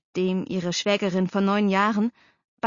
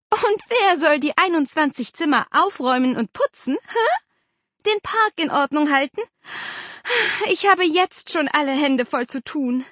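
high, louder, exasperated aunt; indignant scolding; 8 semitones higher, 4 dB louder than usual. A narrator's reading. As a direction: demand to know who does the work. Aunt scolds, objects to housework. (empörte Tante, hoch und schimpfend)

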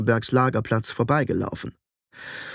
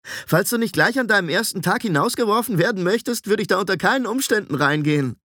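put-on high, boyish teenage apprentice; youthful, higher-pitched warning; 8 semitones higher than usual. A narrator's reading. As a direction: call out a lively warning like a boy. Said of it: "rief eine jungenhafte Stimme".

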